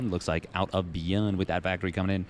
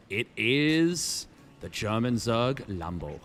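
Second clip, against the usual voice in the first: announcer intro; much slower than usual. Acted.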